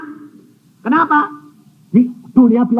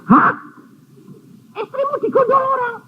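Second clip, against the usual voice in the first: a much higher, quicker shocked question; a high, fast retold gasp of disbelief. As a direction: act out hearing unbelievable news. Pastor retells his shocked reply.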